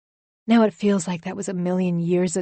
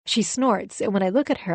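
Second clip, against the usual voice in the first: plain reading voice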